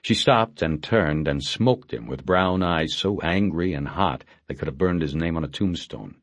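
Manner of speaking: usual speaking voice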